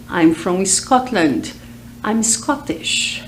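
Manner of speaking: normal talking voice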